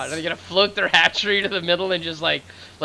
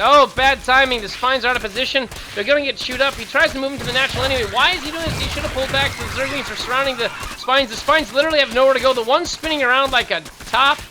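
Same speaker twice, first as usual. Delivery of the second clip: excited play-by-play; 10 semitones higher, 5 dB louder than usual. Real, not acted. Caster reacting to spine crawlers caught out, excited. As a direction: pitch jumps way up and louder, rattling off the action. Caster sees a base collapse.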